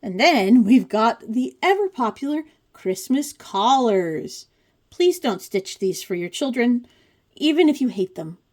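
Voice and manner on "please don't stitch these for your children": usual speaking voice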